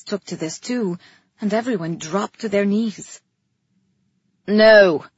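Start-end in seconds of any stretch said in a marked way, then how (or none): 4.5-5.0 loud, sudden shout. A narrator's reading. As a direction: shout it to stop someone. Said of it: "Calyx shouted".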